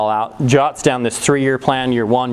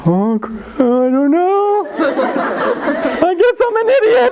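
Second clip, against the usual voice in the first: slow, very high whine; hammed-up helpless whimper, drawn out; mock wail; much slower than usual. Thirteen-year-old admits to an older friend he's lost, mock wail. Younger kid shamed by friend.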